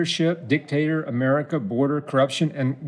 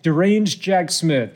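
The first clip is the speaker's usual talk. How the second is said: emphatic read-aloud of a rant; declaimed message reading; 3 semitones higher than usual. Acted. Host reading Trump's Christmas message to Tom, declaiming. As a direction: punch out the insults like proclamations.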